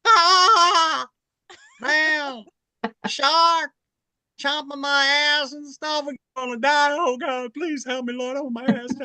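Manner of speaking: hammed-up Richard Pryor terror; Richard Pryor impression. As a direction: scream like Pryor being bitten by a shark. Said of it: "Mister Richard Pryor".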